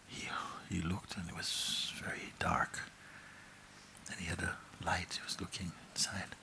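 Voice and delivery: soft, secret whisper; suspenseful whisper. A narrator's reading. Narrating the dark cave to the audience in a whisper. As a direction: whisper it like peering into darkness.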